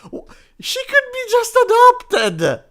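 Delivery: high, whiny put-on girl voice; mock whiny daughter. Teen girl whining at her dad, high mock voice. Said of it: "she's like".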